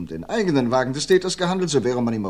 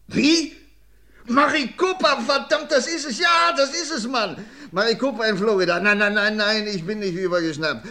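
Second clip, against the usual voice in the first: much higher and louder, bursting with triumph; excited breakthrough; 11 semitones higher, 6 dB louder than usual. Acted. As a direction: shout it out like you just cracked the case. Detective cracks the case.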